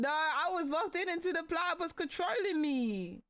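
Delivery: high-pitched, insistent protest; exasperated protest. Real, not acted. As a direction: protest it with a rising wail. Protesting to friend.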